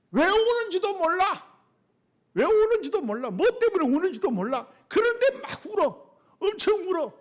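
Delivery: high, impassioned pulpit cry; full-force preaching. Real, not acted. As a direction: drive each phrase home to the congregation.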